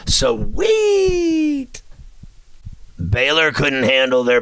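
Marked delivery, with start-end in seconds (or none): 0.1-1.8 high joyful yell; excited shout. Real, not acted. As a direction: shout it out in delight. Celebrating a win.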